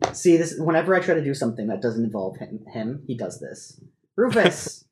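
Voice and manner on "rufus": high, sing-song pleading to the cat; high pet-talk coaxing. Real, not acted. Owner pleading with cat Rufus on the keyboard, high cooing. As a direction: coo at the cat to get him to move.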